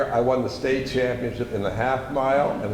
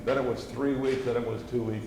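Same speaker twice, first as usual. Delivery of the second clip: ordinary delivery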